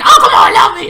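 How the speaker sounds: high, loud laughing plea; mock desperate plea. Mocking how he'd sound begging the girl to love him, loud and laughing. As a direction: ham up the desperation. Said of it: "look very desperate".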